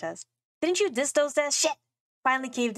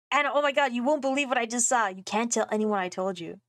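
usual speaking voice

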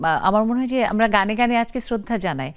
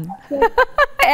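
real laugh; 11 semitones higher than usual. Real, not acted. Host laughs at mataari joke.

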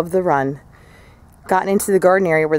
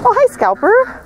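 high sweet pet voice; 3 dB louder than usual. Real, not acted. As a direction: coo up high at the hen. Greeting her favorite chicken.

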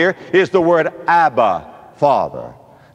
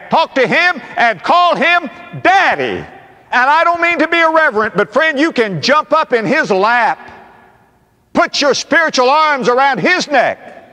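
high, forceful pulpit exhortation; impassioned preaching; 8 semitones higher than usual. Real, not acted. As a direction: urge the congregation with soaring pitch.

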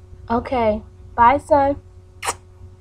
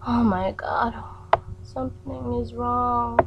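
ordinary talk

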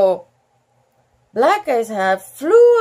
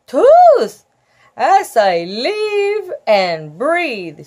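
excited cry; 7 dB louder, much slower than usual. Acted. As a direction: greet him with a glad cry. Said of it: "she cried".

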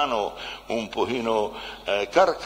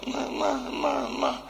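an imitated woman stuck without enough money, pitched up; woman pleading, no money; 11 semitones higher than usual. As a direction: play a flustered woman short of money, stammering high. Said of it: "Apre un borsellino".

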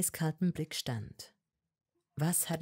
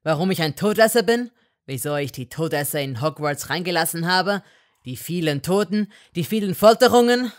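very loud, fast angry tirade; furious outburst; 10 dB louder than usual. A narrator's reading. Malfoy furiously ranting at Hermine. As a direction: hurl his crimes at her.

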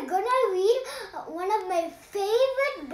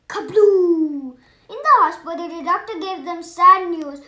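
usual speaking voice

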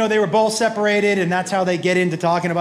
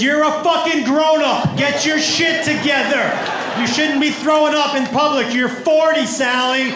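raised, high exasperated shout; good friend scolding; 4 semitones higher than usual. Acted. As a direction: chew her out at full voice. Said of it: "A good friend is".

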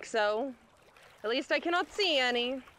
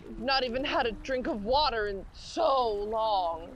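ordinary delivery

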